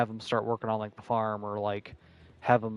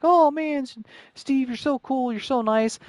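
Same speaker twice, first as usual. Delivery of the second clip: much higher, gushing fan voice; mock gushing fanboy; 13 semitones higher, 3 dB louder than usual. Mocking himself gushing to the janitor Steve, starstruck and high.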